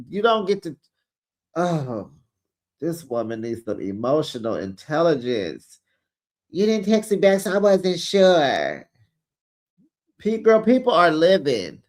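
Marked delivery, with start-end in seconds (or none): 6.5-8.9 mocking whine. Mocking Tisha's words again.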